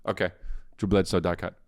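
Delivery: usual speaking voice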